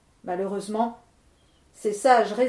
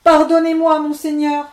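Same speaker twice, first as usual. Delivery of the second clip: higher, louder tearful begging; weeping plea; 6 semitones higher, 12 dB louder than usual. Acted. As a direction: beg through mock tears. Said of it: "dit-il en pleurant".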